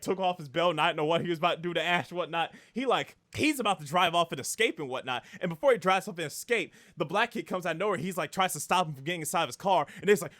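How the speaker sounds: ordinary talk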